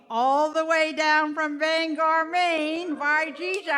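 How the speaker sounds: put-on playful Down East Maine accent. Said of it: "I have to talk in a Maine accent".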